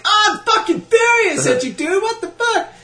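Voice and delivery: high, loud mock fury; furious French friend. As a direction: shriek it like the angry friend.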